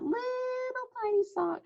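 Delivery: sweet high voice for the little mouse; cute tiny voice. Real, not acted. Teacher shows picture of mouse in sock, cooing.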